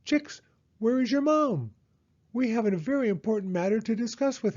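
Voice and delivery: high, quick owl voice; a busy important owl; fussy owl. Acted. Owl asking the chicks for their mother.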